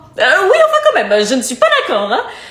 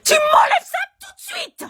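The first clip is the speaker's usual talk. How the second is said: much higher, outraged yell; furious shriek; 13 semitones higher than usual. Acted. Mother shrieking at daughter to remove the piercing. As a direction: explode at her.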